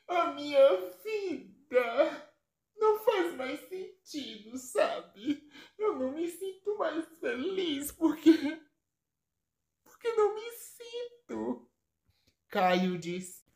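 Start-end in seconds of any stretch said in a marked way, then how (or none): 0.1-11.6 high, soft tearful voice; crying girl. Acted. Drugged sister crying to her brother, high and tearful. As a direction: weep it out in a small high voice. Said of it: "A menina está chorando".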